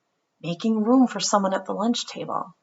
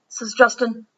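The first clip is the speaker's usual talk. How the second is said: usual speaking voice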